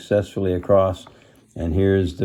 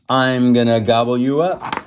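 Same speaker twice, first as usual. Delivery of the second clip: louder, higher troll threat; mildly hammed-up menace; thundering troll; 4 semitones higher, 5 dB louder than usual. A narrator's reading. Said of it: "The troll thundered".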